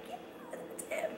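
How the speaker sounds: barely audible covert whisper; retold whisper. Daughter secretly identifying the teacher to her mom, whispered. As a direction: whisper it secretly, imitating herself. Silently signalling mom across office.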